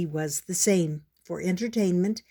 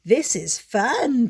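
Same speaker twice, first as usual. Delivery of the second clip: slow, louder, bright piping; piped; 3 semitones higher, 5 dB louder, slower than usual. Acted. Pip piping happily to his brother, louder. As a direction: chirp it happily. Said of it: "piped Pip".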